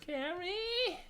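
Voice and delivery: very high, eerie sing-song call; mother waking up, calling her daughter high and eerie. Retold or imitated.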